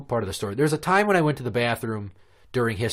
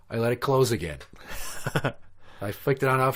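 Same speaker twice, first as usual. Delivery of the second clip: usual speaking voice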